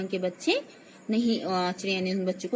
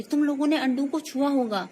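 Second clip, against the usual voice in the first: raised-pitch accusation, acted; angry; 6 semitones higher, 4 dB louder than usual. A narrator's reading. Mother accuses children of touching the eggs, acted anger. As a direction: scold them as an annoyed mother. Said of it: "गुस्से में बोली".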